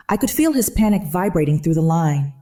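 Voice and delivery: ordinary delivery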